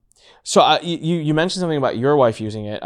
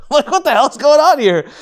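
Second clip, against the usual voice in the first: high, louder half-laughing outburst; mock exasperated outburst; disbelieving outburst; 13 semitones higher, 7 dB louder than usual. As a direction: blurt out the confusion.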